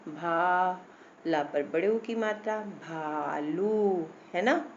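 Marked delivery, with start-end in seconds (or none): 0.1-0.9 भा held long on "aa"; long open vowel. Shown as a demonstration. Teacher showing the aa matra takes time, held syllable. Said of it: "उसमें भी time लगेगा". 2.6-4.1 भालू very slow, "bhaaa-looo"; long drawn-out vowels. Shown as a demonstration. Teacher showing long aa and u take time, stretched syllables. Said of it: "उसमें भी time लगेगा".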